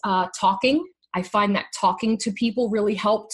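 ordinary delivery

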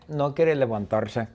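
plain reading voice

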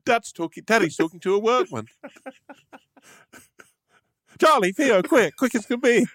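high, hammed-up call to the boys; high mock alarm calling the boys to come watch; mock excited kid caller. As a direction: summon the kids in a silly high voice. Mock-announcing dad talks to workman.

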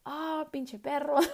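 high, annoyed cousin cursing the dog; cousin's mock curse. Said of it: "primo Beto was like".

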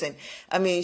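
ordinary delivery

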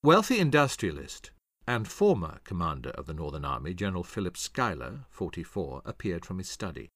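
ordinary delivery

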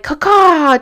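high, loud mock bird squawk; imitated bird call. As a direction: caw it out like a bird. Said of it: "like a fucking bird".

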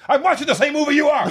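a dad losing patience in a theater; father snapping at his son in a movie theater, exasperated. Retold or imitated. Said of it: "the father turns to him and goes".

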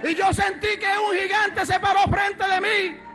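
high, loud, shouting preacher's climax; preaching at full force. Real, not acted. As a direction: build to a roaring testimony climax.

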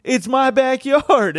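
high, slow mocking lady voice; mock heckler voice. Comic imitating backyard host refusing him the mic, high and slow. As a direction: drag it out like a smug homeowner. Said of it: "And she's like".

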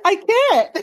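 real laugh breaking into the words. Real, not acted. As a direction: protest helplessly through laughter. Laughing at failed R-rolling.

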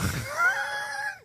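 sudden high-pitched laughing shriek. Real, not acted. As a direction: let a high laugh burst out. Bursts out laughing mid-story.

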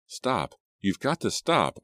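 high-pitched urgent cry, not full volume; mild scream. A narrator's reading. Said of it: "I screamed".

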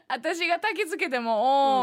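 slow words through laughter; laughing. Real, not acted. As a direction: laugh while you tell it. Laughing while recounting.

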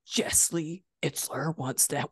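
slow, hushed awe; stunned disbelief. Retold or imitated. Retelling her stunned reaction to Jesse's invite, slow and hushed. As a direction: let the shock sink in word by word. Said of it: "I was freaking out".

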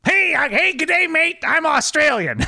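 very high, put-on Australian accent; bad Australian accent. Shown as a demonstration. Voice game on request, hammed-up Australian accent. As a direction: attempt a squeaky-high Australian greeting. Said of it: "do an Australian".

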